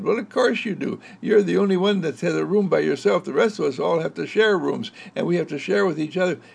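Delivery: put-on children reminding their sister she's lucky; lightly voiced sibling ribbing; teasing siblings. Children tease Audrey.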